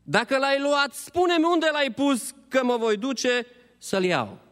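high, imploring question; grieving woman asking, pitched high; pleading Maria. Acted. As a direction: beg him to say where the body is. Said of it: "i-a spus Maria".

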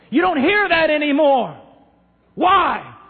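loud, high fiery preaching; preaching at full force. Real, not acted. Preacher rebuking shallow preachers, fiery full-voice preaching. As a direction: hammer the point home to the crowd.

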